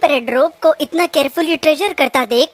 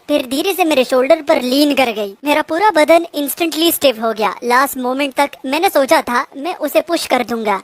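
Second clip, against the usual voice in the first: plain reading voice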